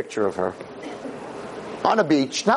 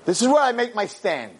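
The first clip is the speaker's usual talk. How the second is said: defiant no; 9 semitones higher than usual. Retold or imitated. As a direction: snap the no back. Friend's defiant refusal.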